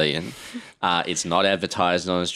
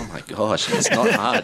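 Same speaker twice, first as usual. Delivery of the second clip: exasperated; 13 semitones higher than usual. Retold or imitated. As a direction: throw up your hands at how easy it is. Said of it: "It's like".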